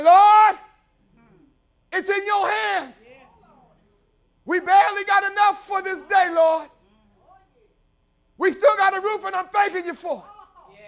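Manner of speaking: high, loud pleading prayer to the Lord; a poor widow begging the Lord; tearful widow's prayer. Retold or imitated. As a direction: cry out to God for help. Widow praying aloud to God.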